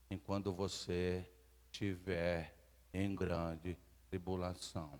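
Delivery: slow, hushed verse reading; scripture reading. Real, not acted. Preacher reading the verse softly to the church. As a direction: read the verse slow and soft.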